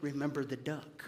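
soft, hushed blackmail; whispered threat. Acted. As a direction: hiss the reminder under your breath. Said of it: "she whispered at him".

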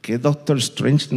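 ordinary delivery

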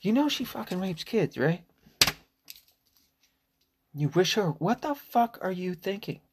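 very high, urgent mock scolding; pulling him aside to set him straight; hammed-up outraged lecture; mock confrontation. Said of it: "I'm like".

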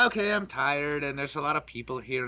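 low, soft, weary imitation; tired, antisocial. Imitating a friend who is normally antisocial, low tired murmur. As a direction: mimic someone withdrawing from the crowd, quietly. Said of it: "Normally she's like".

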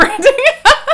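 loud high-pitched burst of laughter; a real shriek of a laugh. Real, not acted. Cohost erupting in high laughter at the joke.

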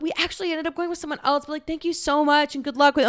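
high, quick polite brush-off; apologetic would-be roommates. Retold or imitated. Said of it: "But they're like".

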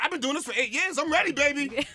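teen karate student bragging, mock cocky; cocky teen boast. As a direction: voice the cocky big kid. Voicing the older kid.

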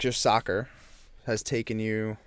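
usual speaking voice

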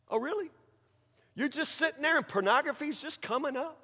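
soft, high-pitched scepticism; mock incredulous. Preacher answering the pornography excuse, incredulous.

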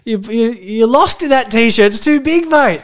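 high mocking laugh-talk; laughing at a friend trying on clothes; teasing. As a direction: tease him about the baggy shirt.

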